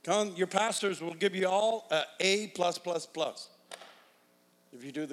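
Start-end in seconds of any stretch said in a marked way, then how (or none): none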